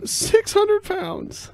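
very high, very slow mocking echo; mimicked incredulous stranger. Co-host parroting the weight guess, high mock disbelief. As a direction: stretch it into a high caricature.

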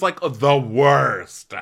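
loud, drawn-out exaggerated disgust; mock teen disdain. Girl complaining to her friend about a boy, mock disdain. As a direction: stretch it slowly into a hammed-up complaint.

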